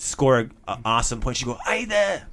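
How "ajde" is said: mock scream. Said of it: "But she would, like, scream it".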